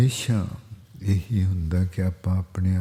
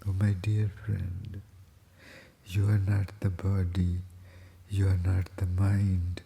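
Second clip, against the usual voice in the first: soft, slow and measured; contemplative consoling counsel; 7 dB quieter, much slower than usual. Acted. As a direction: quietly counsel a dear friend, pausing between phrases. Soft counsel between sung verses. (ਨਰਮ, ਹੌਲੀ ਆਵਾਜ਼ ਵਿੱਚ ਉਪਦੇਸ਼)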